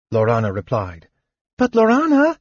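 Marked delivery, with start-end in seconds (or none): 1.6-2.4 high, louder kender protest; crying out. A narrator's reading. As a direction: cry out in worried protest. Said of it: "Tasselhoff cried".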